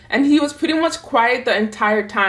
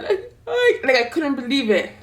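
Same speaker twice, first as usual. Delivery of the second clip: crying; 3 semitones higher than usual. Retold or imitated. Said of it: "I was crying so loud in her office".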